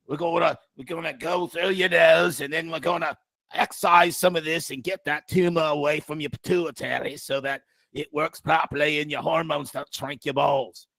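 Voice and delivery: hammed-up Australian surgeon; Australian accent. Aussie surgeon explaining the operation to patient, broad Australian accent. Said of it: "then you have the Australian accent".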